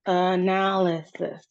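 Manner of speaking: "analysis" stretched out, second syllable held long; separated syllables. Shown as a demonstration. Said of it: "we hear four syllables".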